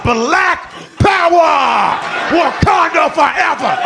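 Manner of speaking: high, slow, drawn-out shout; mock rallying cry. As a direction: bellow it like a hammed-up battle chant. Shouting his secret inner cheer.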